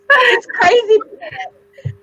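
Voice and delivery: drawn-out high exclamation; excited. Real, not acted. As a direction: stretch it out high, disbelieving. Repeating it for emphasis.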